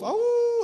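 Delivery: loud, high wolf howl. Acted. Storyteller as another wolf joining in, wolf howl. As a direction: throw out a long howl. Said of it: "He had another wolf".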